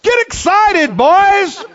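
high, booming exhortation; Brother Hagin's sudden shout. Retold or imitated. Preacher startling drowsy students on the front row, sudden shout. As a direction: jolt the listeners awake with a high yell. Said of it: "and he said".

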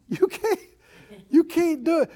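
high-pitched protest; doubtful objection. Acted. The king tells young David he cannot fight, doubtful.

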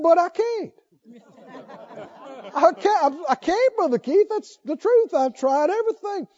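high-pitched, insistent mock pleading; retold desperation. Preacher imitating the young smoker pleading with him, desperate. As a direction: imitate a man protesting helplessly. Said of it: "came to me in desperation".

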